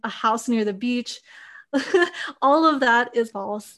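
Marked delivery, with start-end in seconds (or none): 1.5-2.4 quick amused laugh, rising; short laugh. Real, not acted. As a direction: let a laugh slip at the absurd idea. Laughing at wrong assumptions.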